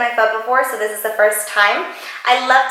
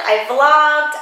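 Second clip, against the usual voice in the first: ordinary talk, nothing marked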